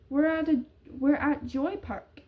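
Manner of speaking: put-on a small child naming where she is; little girl. Girl tells the men on the phone where she is, light child voice. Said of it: "the tiny voice of a little girl".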